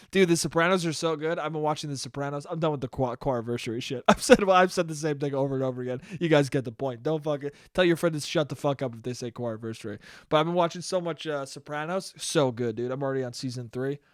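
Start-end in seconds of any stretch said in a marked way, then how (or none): none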